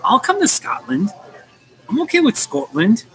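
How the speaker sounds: high-pitched put-on Scottish accent; mock Scottish accent. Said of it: "That's how you say it".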